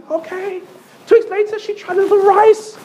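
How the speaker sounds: ordinary delivery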